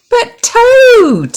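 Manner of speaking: very high, loud pleading call; pleading cry. A narrator's reading. Frog pleading as Toad says good night. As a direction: beg him to stay awake.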